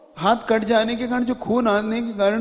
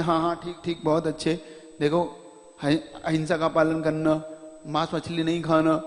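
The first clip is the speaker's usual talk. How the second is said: gentle blessing; 5 semitones lower, 3 dB quieter than usual. Retold or imitated. Guru softly blessing the devotee and giving advice. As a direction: lower and softer, kind blessing. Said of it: "समझाते हैं, आशीर्वाद देते हैं".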